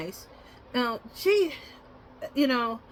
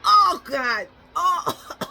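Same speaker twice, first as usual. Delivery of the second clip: disgusted reaction; 7 semitones higher, 7 dB louder, much slower than usual. Retold or imitated. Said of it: "and I was like".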